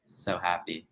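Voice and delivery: deadpan happiness. Pretend. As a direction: say it with no visible thrill. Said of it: "I hear the excitement in your voice".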